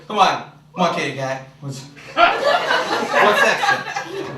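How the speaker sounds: low, slinky come-on; hammed-up seductive voice; mock seductive. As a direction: purr it like a seducer. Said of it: "Nobody said".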